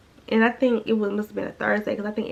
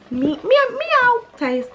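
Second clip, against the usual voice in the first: high, slow, sing-song mocking; quoting the girl's taunt to dad, catty; 6 dB louder, slower than usual.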